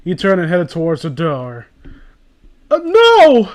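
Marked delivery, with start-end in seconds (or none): 2.7-3.5 high, loud hammed-up scream; mock scream. Reading the fanfic, she fake-screams as he leaves. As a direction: yelp it like a cartoon heroine. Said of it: "screamed and tried to grab him".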